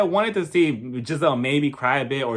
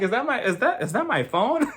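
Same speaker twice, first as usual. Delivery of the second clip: higher, giggly puzzled question; mock confusion; 7 semitones higher than usual. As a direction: play puzzled, a laugh about to break. Joking about a mystery voice.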